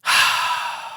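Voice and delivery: long exhaled sigh; wordless sigh. Real, not acted. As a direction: let out a drawn-out breath. Reacts wordlessly to the awkward chat.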